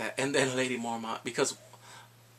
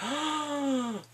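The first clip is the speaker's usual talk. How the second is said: mock gasp; 11 semitones higher than usual. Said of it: "I'm like".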